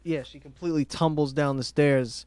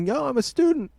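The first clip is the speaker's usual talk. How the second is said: higher-pitched quoted student; acted reply; 10 semitones higher than usual. Student answering the radio guy, acted in a higher pitch. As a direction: answer in a raised, put-on pitch. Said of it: "And he's like".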